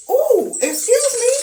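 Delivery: high, slow offended ooh; sassy ooh. Retold or imitated. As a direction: drag it out, taken aback. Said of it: "So I'm like".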